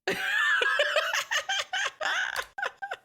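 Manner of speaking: very high squealing laughter; shrieking laugh. Real, not acted. Laughing after his shout.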